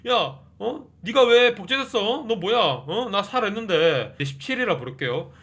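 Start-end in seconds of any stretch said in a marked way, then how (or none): 0.1-4.1 high, loud, startled outburst; shocked shouting. Retold or imitated. Mickey confronts his clone, shocked and loud. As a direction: yell in disbelief at your own clone. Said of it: "서로 막 놀랍니다".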